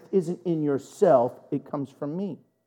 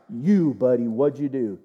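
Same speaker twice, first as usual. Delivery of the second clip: lightly voiced, low interrogator; stern questioner; 8 semitones lower than usual. Leader finally singling out Achan, lightly stern low voice. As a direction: drop the pitch and confront him.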